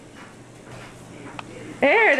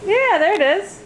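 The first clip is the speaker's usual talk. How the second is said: put-on bright coaxing toddler talk; reading to a toddler; 2 semitones higher, 3 dB quieter than usual. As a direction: coax a little child gently.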